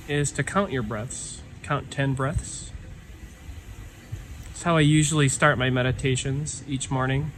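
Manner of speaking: calm meditation guide with long pauses; guided meditation. Real, not acted. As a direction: ease listeners inward, leaving silence between phrases. Leads a breathing meditation.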